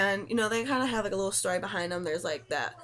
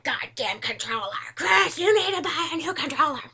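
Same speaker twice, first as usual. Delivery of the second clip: higher, louder frustrated shout; mock angry rant; 7 semitones higher, 6 dB louder than usual. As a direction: yell at boyfriend over the controller. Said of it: "I'm like".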